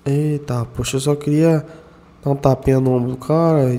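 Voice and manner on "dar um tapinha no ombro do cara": ordinary talk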